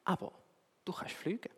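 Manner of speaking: very soft whispered owl; wise old owl. Acted. Wise owl reassures the little bird, whispered. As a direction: breathe it like a gentle old owl.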